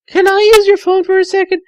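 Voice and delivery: put-on high, fast girlish voice; Tails, sweet and eager; young girl Tails. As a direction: chirp it quickly like a young fox girl. Girl Tails asks Amy.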